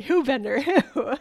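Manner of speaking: hooted "who", tipping into a laugh; imitated questioner. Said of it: "And they'll say".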